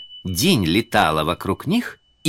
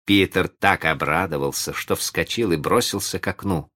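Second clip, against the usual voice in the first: plain reading voice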